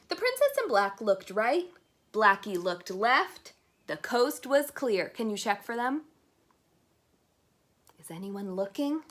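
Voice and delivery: ordinary delivery, nothing marked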